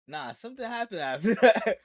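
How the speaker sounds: loud, laughing objection; amused, not buying it at all; laughing disbelief. Real, not acted. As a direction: call him out through a laugh. Laughing disbelief at his claim.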